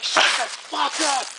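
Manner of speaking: high, drawn-out snap back; retold angry retort. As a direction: act out shutting your uncle down.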